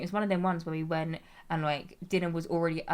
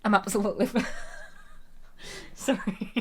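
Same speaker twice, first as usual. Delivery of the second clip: laughing; 3 semitones higher than usual. Real, not acted. Attempting the crisps quote, breaking up laughing. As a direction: try the line and crack up laughing.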